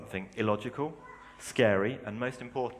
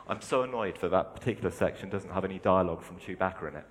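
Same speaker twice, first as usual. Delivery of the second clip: normal talking voice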